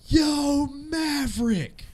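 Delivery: high, drawn-out call across a line. Retold or imitated. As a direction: call out to someone far ahead. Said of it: "I hear".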